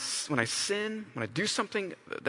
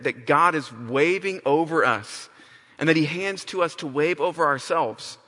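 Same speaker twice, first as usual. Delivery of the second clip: ordinary talk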